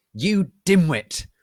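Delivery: slow, loud, high sneering shout; angry insult. A narrator's reading. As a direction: spit the insult out slowly. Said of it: "Snake began to shoot angrily".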